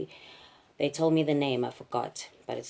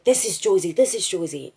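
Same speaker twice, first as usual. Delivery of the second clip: insistent robber; 5 semitones higher, 6 dB louder than usual. Retold or imitated. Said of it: "and he said".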